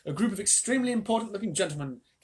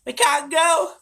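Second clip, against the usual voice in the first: much higher, louder; wailed; 14 semitones higher, 12 dB louder, much slower than usual. A narrator's reading. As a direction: wail it hopelessly. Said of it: "wailed Grandma Georgina".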